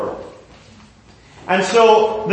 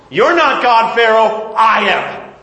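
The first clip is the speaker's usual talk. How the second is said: louder, higher, declaring who is God; booming rebuke; 5 semitones higher, 4 dB louder, slower than usual. Acted. God rebukes a boastful Pharaoh, louder and higher. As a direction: put Pharaoh in his place.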